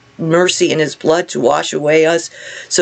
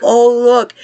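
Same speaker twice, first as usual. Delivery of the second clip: high, louder gasp of wonder; awed exclamation; 7 semitones higher, 5 dB louder than usual. Retold or imitated. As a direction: marvel at it out loud. Awed at touching Jesus's babies.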